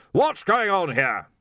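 slow, loud demand. Acted. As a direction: boom the question slowly. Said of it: "he demanded".

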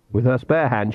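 ordinary delivery, nothing marked

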